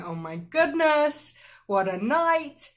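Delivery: loud, drawn-out inner groan of dismay; exasperated. Retold or imitated. Exasperated thought acted out.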